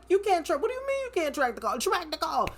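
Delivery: a terrified caller pleading with the 911 dispatcher, frantic and high; panicked disbelief. Retold or imitated. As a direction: shriek it like you can't believe him. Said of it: "So I'm terrified".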